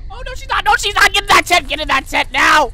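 high, loud panicked ordering; frantic command. Real, not acted. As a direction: shout it urgently, dropping in pitch at the end. Ordering baby back into tent.

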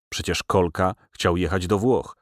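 plain reading voice

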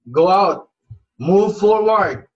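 loud, forceful preacher's command; rousing exhortation. Real, not acted. Preacher exhorting listeners to act.

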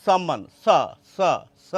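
সম্মান as "sam-man", then স as separate "sa"; dental s pronunciation. Shown as a demonstration. Teacher isolating the sound for viewers, স as dental "sa". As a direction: isolate the hissed s of স after সম্মান. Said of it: "দন্ত স এর উচ্চারণ দন্ত সাই করছেন".

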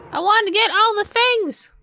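whiny wail. Real, not acted. Whiny outburst over missed loot.

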